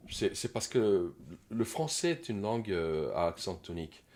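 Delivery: ordinary talk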